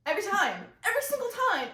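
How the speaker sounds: "Every single time" punched high and loud; loud, high insistence on every word; emphatic. Real, not acted. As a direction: hit "Every time" hard, pitch leaping up. Emphatic point about how it always went.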